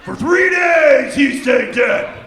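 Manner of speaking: loud, high, slow hyped preacher; rally the pit like a preacher; mock preacher hype.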